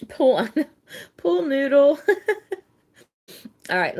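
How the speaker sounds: laughing through the words, pitch jumping up high; can't stop giggling mid-sentence. Real, not acted. Host laughing at Nikki tugging during the game.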